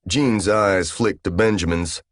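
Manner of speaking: ordinary delivery, nothing marked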